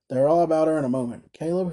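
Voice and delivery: ordinary delivery, nothing marked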